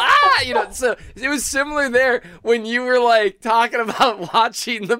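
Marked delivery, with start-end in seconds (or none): 0.0-0.4 high mock yelp. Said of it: "And you're like".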